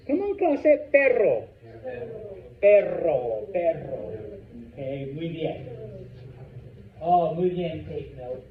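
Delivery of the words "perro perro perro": each "perro" with a strong trilled rr; rolled R in perro. Shown as a demonstration. Teacher drills the class, rolled R in "perro". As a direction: model "perro" word by word, R rolled.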